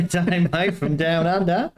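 loud, high, hammed-up Aussie accent; mock Australian accent. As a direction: belt it out in a put-on Australian accent. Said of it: "for the Australians".

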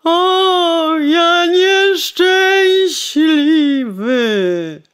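high, loud, very slow wail on nieszczęśliwy; wailing lament. Acted. Coachman groaning over his dead horse, high and loud. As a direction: draw nieszczęśliwy out in a long crying groan. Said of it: "jęknął woźnica".

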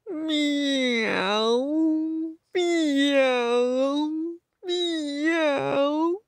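long, high wailing miaow; very sad meow. Acted. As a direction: mew like a self-pitying cat. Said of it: "that very sad meow".

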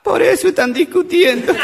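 voz muy aguda de nena llorona; niña llorosa. Acted. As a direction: remata imitando el llanto de una chiquita. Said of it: "Estaba una chiquita llorando". (niña llorando, exagerada en broma)

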